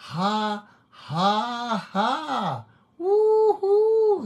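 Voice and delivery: high ha-ha into loud woohoo; joyful whoop up high; ecstatic whoop. Real, not acted. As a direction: burst out in joy. Ecstatic worship outburst.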